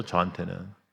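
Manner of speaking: normal talking voice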